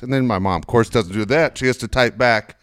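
usual speaking voice

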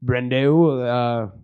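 hammed-up older English gentleman; debonair English gentleman. Host greets his friend at the airport, mock-debonair British. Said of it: "some debonair older English gentleman's like".

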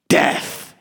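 breathy dragon whisper; whispered threat. Acted. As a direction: whisper death like a curse. Smaug's final threat.

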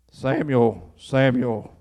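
low, resonant call of a name; God calling. Acted. God calling the sleeping boy by name, low call.

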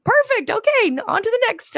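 high chirpy cast member voice; cheery, sing-song, moving right along; bright squeaky sing-song welcome. Retold or imitated. Staff cheerily approving her.

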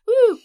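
high, loud cheering "woo"; excited whoop. Real, not acted. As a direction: let out a sudden celebratory whoop. Cheers at the letter's line.

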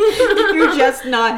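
laughing emphasis. Real, not acted.

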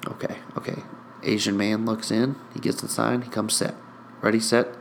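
quiet suspenseful sportscast; hushed play-by-play. Acted. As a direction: murmur the windup as it happens. Calls the pitch in a hush.

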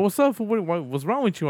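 high, concerned hello; mock concerned greeting. Greeting a coworker, high and concerned. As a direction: ask what's wrong in a high voice. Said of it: "And like".

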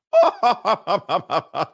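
high, loud-starting fake staccato laugh; hammed-up forced ha-ha-ha; marital laugh of doom. Mocking married couples, fake laugh of doom. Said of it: "The marital laugh of doom".

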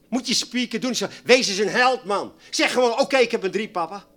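loud, fired-up sermon; full-force preaching. Acted. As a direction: hammer it home to the congregation. (vurig preken, luid)

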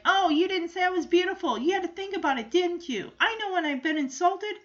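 offended Lucy rant. Retold or imitated. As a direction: huff like a vain girl who feels snubbed. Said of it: "she goes on a, on a little rant".

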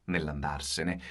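usual speaking voice

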